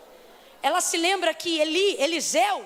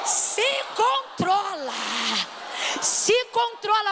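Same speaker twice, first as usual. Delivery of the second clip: slow, drawn-out se controla; slow firm command; 5 semitones higher, much slower than usual. Real, not acted. Congregation tells the woman beside them, slowly and firmly. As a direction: tell her firmly to control herself. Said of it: "é três, vai".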